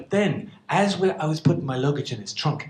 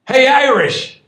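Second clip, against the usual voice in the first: loud, higher call across the room; host calling to the Irish guest, loud call; 10 semitones higher, 11 dB louder, slower than usual. Retold or imitated. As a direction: shout a friendly greeting.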